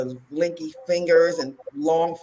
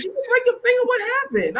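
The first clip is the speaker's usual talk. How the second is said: imitating worried mother; 3 dB louder than usual. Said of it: "My mother kept on asking".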